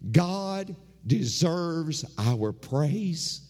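rhythmic pulpit preaching, rising and falling; sermon cadence with repeated refrains. Acted. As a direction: preach it to the congregation.

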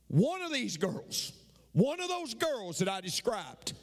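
ordinary delivery

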